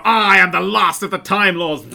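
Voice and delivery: loud, slow, pompous proclamation; grand Doctor declaration. Retold or imitated. Mocking a dramatic Doctor's speech, slow and loud. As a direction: declaim it like a tragic hero. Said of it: "this isn't a Doctor that's gonna go".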